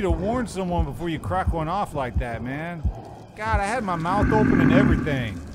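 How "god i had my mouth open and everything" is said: loud, high, disgusted complaint; gagging outrage at a buddy's fart. Real, not acted. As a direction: howl in real disgust at the smell.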